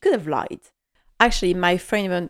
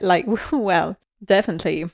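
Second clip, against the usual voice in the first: ordinary talk, nothing marked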